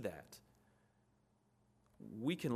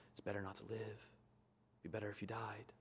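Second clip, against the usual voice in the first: hushed tempting voice; 9 dB quieter than usual. Retold or imitated. Quoting Satan's lies.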